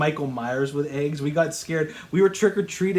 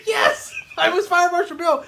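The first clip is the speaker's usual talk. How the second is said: high-pitched excited yes through laughter; excited, high-pitched confirmation; delighted recognition; 13 semitones higher, 4 dB louder than usual. Real, not acted. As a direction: burst out laughing, thrilled they guessed it. Delighted his costume was guessed.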